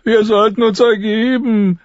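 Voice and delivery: very high, whiny character voice; thin high voice of a scared companion; Lommi. A narrator's reading. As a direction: propose giving up in a high-pitched whine. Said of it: "meinte Lommi".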